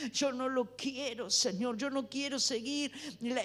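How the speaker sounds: earnest prayer voiced for the congregation; led prayer. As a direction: pray it aloud for them to follow. Leads a spoken prayer.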